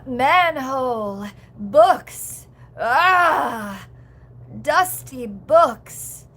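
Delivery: slow, drawn-out words with loud breathy bursts; hammed-up slow movie-character delivery, big pauses; Anna Faris impression. Streamer quoting House Bunny to viewers, exaggerated slow impression. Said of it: "That's how I do an Anna Faris impression".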